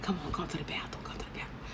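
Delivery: breathy whispered invitation. Retold or imitated. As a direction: whisper it like a secret come-on. Drunk self luring guy to bathroom.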